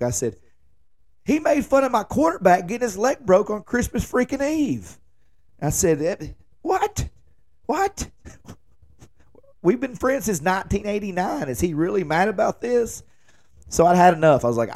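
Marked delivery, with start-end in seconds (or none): 1.2-5.0 much higher, worked-up disbelief; incredulous protest. Retold or imitated. To Mackie about James's anger, high incredulous protest. 6.0-13.0 much higher, squeaky "what? what?"; stunned disbelief. Retold or imitated. To Mackie, hearing James is mad, squeaky disbelief. Retold disbelief at friend's anger.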